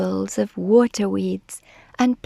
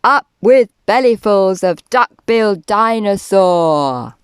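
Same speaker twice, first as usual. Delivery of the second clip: loud tyrannosaur chant; loud, full chant at ordinary pitch; shouting; 6 dB louder than usual. A narrator's reading. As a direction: shout it as a greedy rallying cry. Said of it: "And they shouted".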